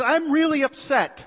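acted-out annoyance, pitched well up; angry complaint. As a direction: play the indignant man lodging a complaint. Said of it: "He's pretty angry about it".

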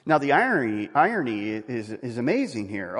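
usual speaking voice

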